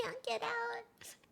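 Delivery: slow breathy whisper. Real, not acted. Giggling aside at the scene.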